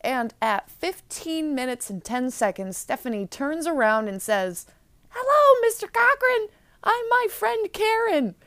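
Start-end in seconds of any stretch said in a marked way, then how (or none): 5.1-8.3 obvious fake voice, very high and loud; Karen. Disguised girl greets her uncle as her friend, obvious fake voice. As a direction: put on a squeaky-high, badly faked disguise voice. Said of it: "In an obvious fake voice".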